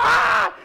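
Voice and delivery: sudden high, loud imitated wail; hammed-up scream of grief; mock mourner's scream. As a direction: scream out like a bereft mourner, acted. Imitates mourner as casket closes.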